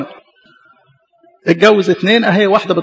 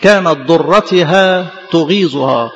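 slow scripture reading; much slower than usual. Acted. Slow scripture quote of rival.

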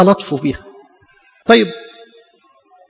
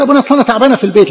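quick mock complaint; 5 semitones higher, 4 dB louder, much faster than usual. Imitating a complaining girl.

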